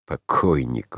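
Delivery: low, understated wry remark; wry scoff. A narrator's reading. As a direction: drop it low with a touch of mockery. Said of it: "усмехнулся Франц". (негромко, с лёгкой усмешкой)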